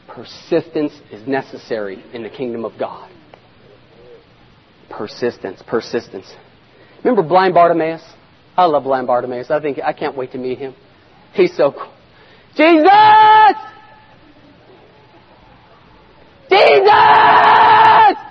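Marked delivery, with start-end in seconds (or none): 0.1-6.4 low, soft and measured; quiet, low-pitched solemn preaching; hushed emphasis. Real, not acted. Preacher drops after shouted challenge. 12.6-18.2 long, high holler; yelling out. Acted. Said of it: "this guy's yelling out".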